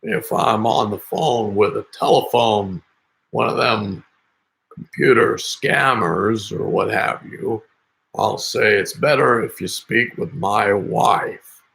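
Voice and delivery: put-on slow, raspy old man on the phone. Shown as a demonstration. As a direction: stretch it out like an old codger. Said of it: "I'll go from the old man to the old lady".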